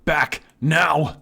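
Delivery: high, loud alarm call; urgent shout. Acted. Narrating the fire trap to the viewers, urgent shout. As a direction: yell it as the trap fires. Reading a sudden escape alarm.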